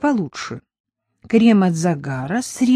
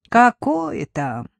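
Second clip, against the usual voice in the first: медленно, горестно вздыхая; махнуть рукой с тяжёлым вздохом; 5 semitones higher, much slower than usual. Acted. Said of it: "вздыхала в ответ тётка". (тётка горестно вздыхает о муже)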